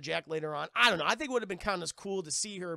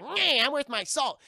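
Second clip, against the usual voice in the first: high, loud, drawn-out dwarf voice; hammed-up dwarf, pitched high and slow; little dwarf; 8 semitones higher, 9 dB louder than usual. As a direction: pipe up loudly as a little dwarf. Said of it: "a little dwarf who's like".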